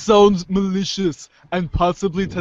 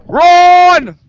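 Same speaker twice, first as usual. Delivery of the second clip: sudden shrill yell of a name; high piercing shout across the room; loud call; 12 semitones higher, 12 dB louder than usual. Acted. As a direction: call out at the top of your lungs. Calling out across the scene.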